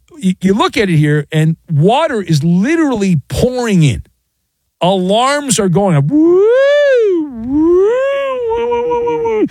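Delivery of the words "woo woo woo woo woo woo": very high siren wail, woo woo; imitated alarm siren. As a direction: whoop like a ship's alarm going off. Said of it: "That's weird sounding alarms".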